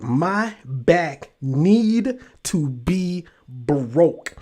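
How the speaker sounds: "my back need to be broke", very slow, word by word; dragged-out, weighty stress on "back" and "broke"; emphatic wording. Real, not acted. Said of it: "why do I keep emphasizing the word back".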